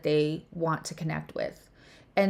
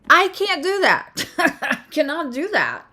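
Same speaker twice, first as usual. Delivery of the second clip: excited laughing admission; 7 semitones higher, 4 dB louder than usual. Real, not acted. Admiring friend's gift, laughing.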